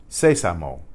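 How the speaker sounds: Sésamo said SE-sa-mo; Spanish Sésamo, weight up front; first-syllable stress. Shown as a demonstration. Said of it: "with an accent on the first".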